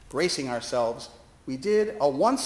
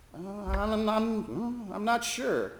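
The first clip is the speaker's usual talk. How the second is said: higher, hesitant stammering; flustered stammer; 7 semitones higher, 4 dB quieter, much slower than usual. Retold or imitated. Chaplain caught out by the quiz, flustered stammer. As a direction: stumble over the words nervously.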